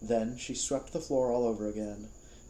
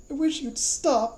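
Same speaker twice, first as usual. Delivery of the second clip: high, loud whine; high pleading whine; 7 dB louder than usual. Acted. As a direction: beg her to stop. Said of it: "Michael said".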